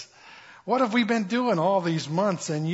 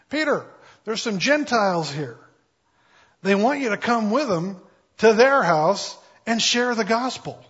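announcing Lord; 5 semitones higher, 5 dB louder than usual. Acted.

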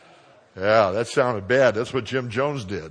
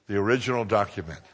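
usual speaking voice

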